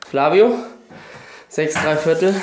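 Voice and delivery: loud, fast hype-up call; gym bro. Real, not acted. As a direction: pump yourself up before the set. Announces his set, hyped up.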